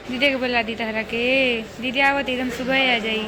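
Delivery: coaxing, sing-song comfort, a bit loud; gentle reassuring coaxing. Real, not acted. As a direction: hush a tearful little one and promise her sister is coming. Comforting a crying little girl.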